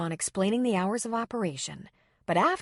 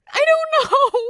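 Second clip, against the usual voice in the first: high breaking tearful voice; beginning to cry; 12 dB louder than usual. A narrator's reading. Girl answering Kelly, starting to cry. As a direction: voice cracking into tears. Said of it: "beginning to cry".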